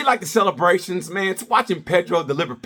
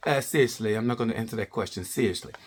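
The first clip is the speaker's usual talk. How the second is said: lower, softer, bored snark; mock snarky Pecco; 7 semitones lower, 7 dB quieter than usual. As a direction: dismiss the interview question deadpan. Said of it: "Pecco is low-key snarky".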